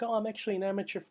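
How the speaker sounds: high, mocking, put-on voice; sing-song mock of a braggy amateur; mocking bridesmaid. Mimicking the bridesmaid photographer, high and mocking. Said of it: "She's like".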